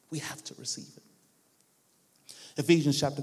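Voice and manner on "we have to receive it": very soft, nearly whispered; hushed emphasis. Real, not acted. Preacher repeats the key point to congregation, hushed and earnest. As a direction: let the repeat fall away to a hush.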